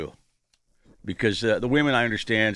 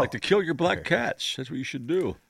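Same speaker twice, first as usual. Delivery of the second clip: sarcastic; 6 semitones higher than usual. Pretend. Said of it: "I'm just being sarcastic".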